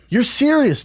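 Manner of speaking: high-pitched acted disbelief; incredulous. Cyclist to the boyfriend, retold disbelief.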